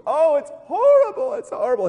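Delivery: hammed-up distraught woman crying out; mock wailing. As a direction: wail it in mock despair. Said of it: "crying her eyes out, like, wailing".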